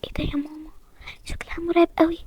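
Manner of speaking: hushed, frightened whisper; breathless whispered fright; scared whisper. Acted. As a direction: whisper so the new girl won't hear. Whispering about the scary new girl.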